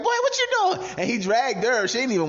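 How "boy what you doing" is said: squeaky mock wife, much higher and louder. Host mimics the cheater's wife being dragged out, high mocking squeak. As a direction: play the confused wife pulled from the bathroom, squeal it.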